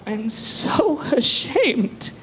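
slow, hammed-up tragic actress line; milk it like a diva on set; great actress. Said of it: "That's the great actress".

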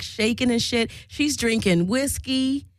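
ordinary delivery

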